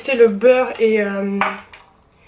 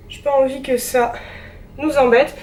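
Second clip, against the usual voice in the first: ordinary talk, nothing marked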